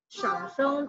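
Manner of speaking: Shangsheng dipping down then rising. Shown as a demonstration. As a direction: Shangsheng in the third, falling-rising tone. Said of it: "turun naik".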